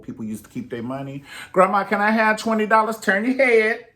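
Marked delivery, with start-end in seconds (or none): 1.5-3.9 put-on higher, louder child voice; child asking grandma. Grandchild begging grandma for twenty dollars, high eager voice. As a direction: beg like a little grandchild.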